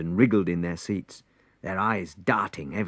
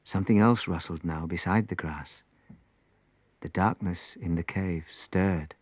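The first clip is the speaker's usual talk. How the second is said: ordinary delivery, nothing marked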